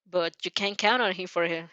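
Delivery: ordinary talk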